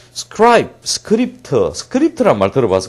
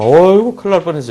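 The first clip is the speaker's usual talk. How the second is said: high, louder "phew, close call" exclamation; acted relieved alarm; 6 semitones higher, 6 dB louder, faster than usual. Teacher imagines waking from the dream, startled and relieved. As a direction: exclaim like someone who just escaped trouble. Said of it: "우리 같으면".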